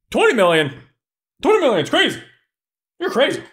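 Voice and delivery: high-pitched disbelief; incredulous. Retold or imitated. Kaz reacting to the twenty million bounty, incredulous. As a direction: balk at it, pitch jumping up.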